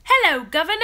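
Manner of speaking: loud, high, hammed-up British accent; bad British accent. Greeting a live viewer from London, bad British accent. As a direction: greet them in an exaggerated, badly faked British accent. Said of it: "Why does my accent sound so bad".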